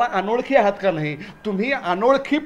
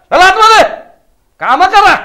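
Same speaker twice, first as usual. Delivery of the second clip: loud, high-pitched bossy shout; scolding order; 7 semitones higher, 9 dB louder than usual. Acted. Master sends idle servant inside, scolding loudly. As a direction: order them sharply back to work.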